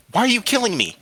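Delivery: high, loud, pleading demand; anguished question. A narrator's reading. Boy to the wind killing him, anguished. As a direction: demand to know why, in distress.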